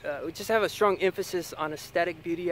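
ordinary delivery

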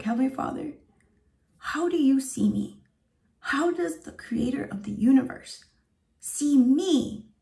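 high, slow prayer, moved nearly to crying; close to tears. Retold or imitated. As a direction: ask it in awe, pitched high, on the verge of tears. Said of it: "I'm gonna start crying".

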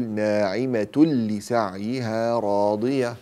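slow ناعمة لسعيها راضية, tanween turned into a pure doubled l; drawn-out recitation, n absorbed into لسعيها; merging without ghunna. Shown as a demonstration. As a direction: join ناعمة to لسعيها on a firm l, no nasal hum. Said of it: "إدغام بغير غنة".

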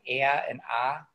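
"a" and "ah" said strong, a bit through the nose; strong nasal vowels. Shown as a demonstration. Chicagoan showing local vowels, nasal "a" and "ah". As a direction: lean on "a" and "ah" with a nasal Chicago sound. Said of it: "sounds that are also a bit more nasally".

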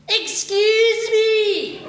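high, slow, drawn-out posh "Excuse me!"; posh snooty customer. Retold or imitated. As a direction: imitate the snooty woman, high and haughty. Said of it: "You know that fucking voice?".

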